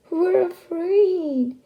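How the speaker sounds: high, loud and slow frightened fish; fish crying afraid. Acted. The fish crying to the rooster, high, loud and slow. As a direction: wail it out slowly, scared. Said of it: "cried the fish".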